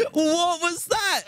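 high, slow, cracking up in disbelief; laughing. Real, not acted. Player hearing a goat scream like a human, laughing high. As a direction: laugh through the shock.

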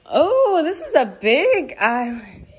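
high, loud and slow in wonder; big excited gasp of wonder; amazed. Real, not acted. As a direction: marvel at it out loud. Amazed at the kitchen.

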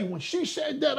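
ordinary delivery

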